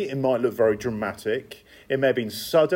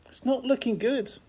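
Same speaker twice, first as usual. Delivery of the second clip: higher and softer mock dismay, dropped back; quiet echo; 9 semitones higher, 4 dB quieter than usual. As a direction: repeat it as a hushed rueful aside. Preacher repeats the bleak verdict.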